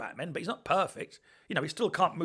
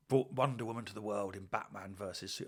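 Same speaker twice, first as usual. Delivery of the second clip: usual speaking voice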